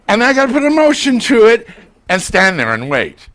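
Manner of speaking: usual speaking voice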